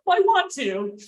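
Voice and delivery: high, lightly imitated insistent date; mock-eager date. Imitating date insisting on walking her out, eagerly. Date's insistence acted in story.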